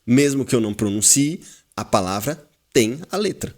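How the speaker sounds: usual speaking voice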